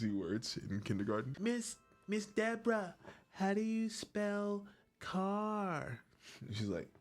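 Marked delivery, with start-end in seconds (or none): 1.4-6.0 put-on child voice. Child asking kindergarten teacher.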